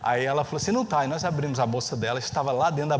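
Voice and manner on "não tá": higher, insistent denial; a teen flatly refusing it; protesting daughter. Retold or imitated. Daughter denying to her dad that she has the phone, higher and insistent.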